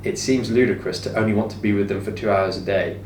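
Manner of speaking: ordinary delivery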